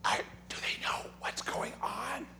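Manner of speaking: soft, breathy mock churchgoer whisper; gossipy whisper. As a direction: hush it like a nosy pew neighbour. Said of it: "We say it in our whisper voice".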